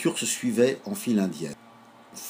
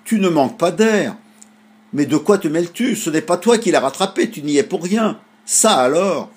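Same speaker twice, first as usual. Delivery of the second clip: put-on higher, louder child scolding the gull; indignant scolding; 7 semitones higher, 6 dB louder than usual. A narrator's reading. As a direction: tell the gull off, offended. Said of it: "indignés". (réprimande indignée, plus aiguë)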